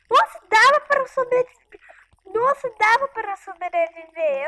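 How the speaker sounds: high, loud exasperated exclaim; exclaiming loudly. Real, not acted. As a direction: burst out in frustration. Repeats after dying, loud. (exclamação alta e indignada)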